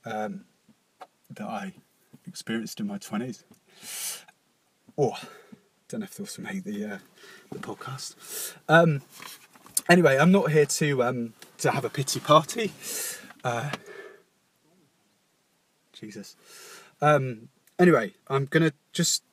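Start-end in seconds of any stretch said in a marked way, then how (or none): 0.1-8.5 very quiet, faltering through real tears; words nearly give out as you choke up; holding back tears. Real, not acted. Podcaster confessing a gambling addiction, fighting tears. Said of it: "that little blip there with the tears". 11.9-16.4 barely audible, overcome; holding back tears. Real, not acted. Podcaster overcome while recording a confession, holding back tears. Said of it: "that little blip there with the tears".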